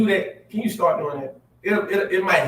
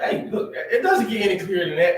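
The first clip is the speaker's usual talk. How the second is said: ordinary delivery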